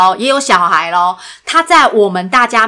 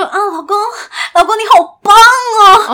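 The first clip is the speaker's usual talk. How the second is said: mock moaning; 9 semitones higher than usual. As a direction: overplay a breathless wife in bed. Mock bedroom talk about wife. (夸张地模仿床上呻吟)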